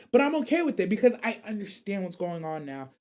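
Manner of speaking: normal talking voice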